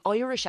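"Irish" said as "Oirish"; broad Irish pronunciation. Real, not acted. Actor on accent class, "Irish" as "Oirish". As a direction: round the first vowel of "Irish" to "oy". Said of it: "That came out, like, Irish".

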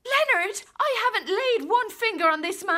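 high, loud, desperate denial; pleading protest. Acted. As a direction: beg him to believe you. Wife pleads innocence in quarrel.

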